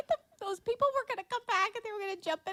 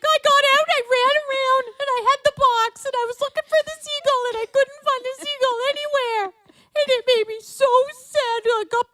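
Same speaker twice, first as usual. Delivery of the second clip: mom retelling, higher and louder; imitating mom; 6 semitones higher, 7 dB louder than usual. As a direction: mimic mom's frantic account. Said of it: "And she's like".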